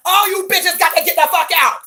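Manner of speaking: loud, high yell; pretend party eviction. Host throwing guests out of her party, loud yelling. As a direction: holler it to clear the room.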